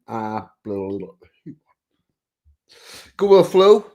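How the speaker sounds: ordinary talk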